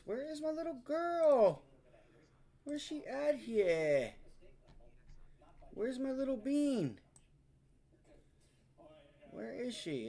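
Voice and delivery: high sing-song calling; calling a pet. Real, not acted.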